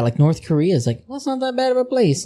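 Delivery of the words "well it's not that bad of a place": mocking quote. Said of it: "Was saying that".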